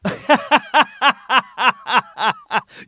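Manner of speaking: loud burst of real laughter; hearty laugh. Real, not acted. Big laugh at joke.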